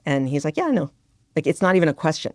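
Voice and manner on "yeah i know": son's reply. Retold or imitated.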